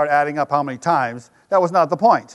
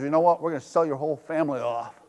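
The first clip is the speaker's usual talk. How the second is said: stern banker; 4 semitones lower, 9 dB quieter, faster than usual. Retold or imitated. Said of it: "The bank says".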